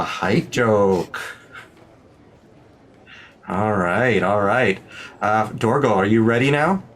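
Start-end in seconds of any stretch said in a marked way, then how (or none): none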